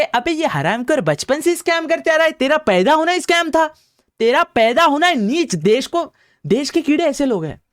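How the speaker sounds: high-pitched, outraged insults; worked-up rant, pitched high and forceful; angry tirade. Real, not acted. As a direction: let real disgust push the voice way up. Insulting the scam-accused minister.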